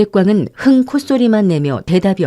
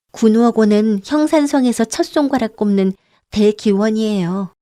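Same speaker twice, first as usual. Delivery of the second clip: lightly voiced girl, higher; girl explaining; 4 semitones higher than usual. Girl character answering. (조금 높은 소녀 목소리로 설명)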